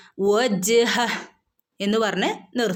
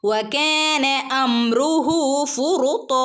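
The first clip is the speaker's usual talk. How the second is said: slow, chanted verse reading; Quran recitation; 4 semitones higher, much slower than usual. Shown as a demonstration. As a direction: recite it slowly like in class. (പതുക്കെ ഖുർആൻ പാരായണം)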